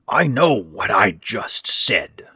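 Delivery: rasped. A narrator's reading. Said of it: "Ben rasped".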